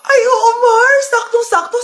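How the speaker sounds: high, loud, gasping eagerness; hammed-up delighted yes; eager. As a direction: jump at the lucky chance. Eager to offer own child.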